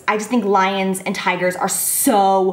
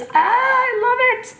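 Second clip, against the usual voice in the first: dreamy delight; 14 semitones higher, slower than usual. Real, not acted. Dreamy sigh over France.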